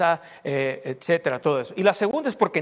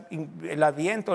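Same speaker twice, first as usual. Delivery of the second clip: ordinary talk, nothing marked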